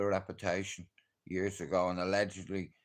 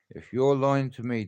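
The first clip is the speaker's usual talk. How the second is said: threat; 5 semitones higher, 6 dB louder than usual. Retold or imitated. Threatening the informant.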